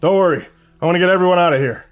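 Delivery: Jerry rallying the captives; eager reassurance. A narrator's reading. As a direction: rush to reassure everyone.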